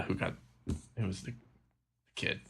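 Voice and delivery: ordinary delivery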